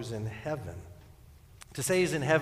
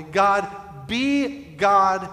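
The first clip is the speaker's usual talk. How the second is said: loud, raised cry to God; emphatic prayer; 6 semitones higher, 8 dB louder, much slower than usual. Acted. Preacher putting the prayer in other words, emphatic. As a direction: hit each word hard, pausing after God. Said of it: "In my praying".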